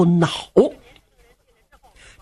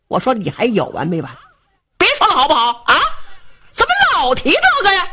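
rising higher and sharper, fed up; glaring son; 6 semitones higher, faster than usual. Acted. Son glaring at his father, telling him to stop, annoyed. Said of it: "冲着老头一瞪眼".

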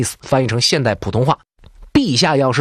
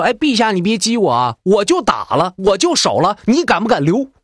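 heated challenge; 9 semitones higher than usual. Retold or imitated. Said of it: "李刚说".

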